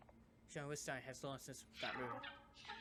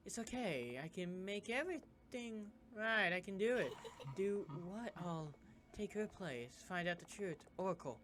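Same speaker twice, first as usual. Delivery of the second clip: ordinary talk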